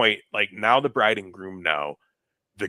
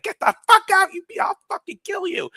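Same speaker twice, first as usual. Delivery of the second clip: very high mock scream of rage; screaming threat; 4 dB louder than usual. Bride getting in the dad's face, screamed threat.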